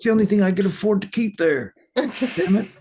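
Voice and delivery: high, agitated, comic indignation; defensive protest. Acted. Randy explains his little pistol to mockers, defensive. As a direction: protest it with the pitch shooting up.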